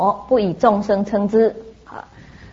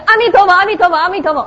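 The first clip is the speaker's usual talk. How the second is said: high, louder rapid-fire chanting; imitating eager children; 8 semitones higher, 3 dB louder, faster than usual. Three children reciting for a coin each, eager mock chant. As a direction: rattle it off like kids after a reward.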